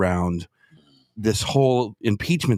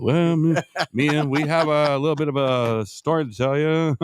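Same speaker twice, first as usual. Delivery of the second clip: lightly put-on hesitant announcer; mock announcement; 5 semitones higher than usual. Host imagining a couple's coming-out statement, mildly put-on voice. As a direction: act out an awkward confession, a little higher.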